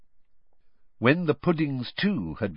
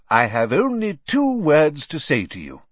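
booming headmaster; 7 semitones higher, 6 dB louder than usual. A narrator's reading. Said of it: "his deep voice echoing around the hall".

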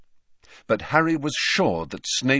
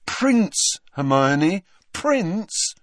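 high, emphatic insistence; cross, pointed correction; 11 semitones higher, 4 dB louder, much slower than usual. A narrator's reading. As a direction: hammer each word in annoyance. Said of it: "said Harry crossly".

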